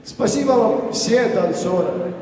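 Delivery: usual speaking voice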